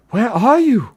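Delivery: high, loud wailing call; anguished high shout; miserable cry. A narrator's reading. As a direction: cry out desperately for lost friends. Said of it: "He cried out miserably".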